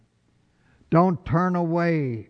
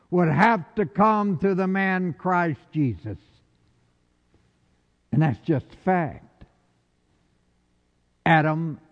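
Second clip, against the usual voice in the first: ordinary talk, nothing marked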